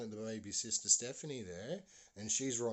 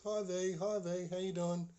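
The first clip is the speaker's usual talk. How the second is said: higher-pitched, singsong hello; cheery neighbour greeting; 8 semitones higher, 4 dB louder than usual. Retold or imitated. Neighbour waves to face in window, high cheery voice. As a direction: call a friendly hello up to a window.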